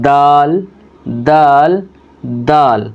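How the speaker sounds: "دال" with the tongue at the tooth roots; letter demo. Shown as a demonstration. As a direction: model "دال" firmly. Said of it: "اس کو ادا کرنے کا طریقہ".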